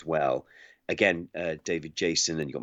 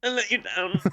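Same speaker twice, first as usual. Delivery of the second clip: mock apology; 13 semitones higher than usual.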